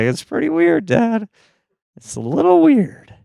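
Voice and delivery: very high, uncomfortable mock teen voice; squeaky awkward protest. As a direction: object timidly in a squeak, pitched far up.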